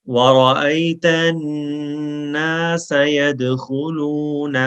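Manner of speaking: slow, melodic tajweed chant; Quran recitation. Shown as a demonstration. Teacher reciting for students to repeat after, slow melodic chant. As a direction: recite it slowly for students to echo.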